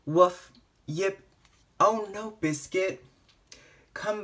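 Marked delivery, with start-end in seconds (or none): 0.0-0.5 loud, higher single woof; a big excited puppy bark; loud storybook bark; dog bark. Acted. Dog barks in picture book. 0.8-1.2 one higher little yip; small puppy yip. Acted. Puppy yips in picture book.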